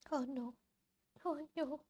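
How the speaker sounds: ordinary delivery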